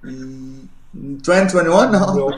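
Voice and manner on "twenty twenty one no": louder, a rising question in disbelief; incredulous echo. Real, not acted. Echoing the interviewer's guess of the year, incredulous. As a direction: repeat the year as if it's absurd.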